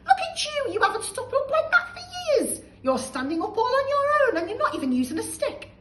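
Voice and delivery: put-on high, loud, thrilled boy; delighted cry. George amazed grandma stands, delighted cry. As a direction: cheer her on with glee.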